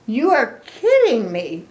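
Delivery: can't believe what she's hearing; shocked disbelief. Acted. Piggy shocked by Gerald.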